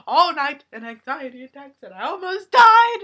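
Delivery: high, loud mock wail; exaggerated near-hysterical complaint, imitated; hammed-up distress. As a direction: wail it like a melodramatic customer. Mimicking customer's overblown distress.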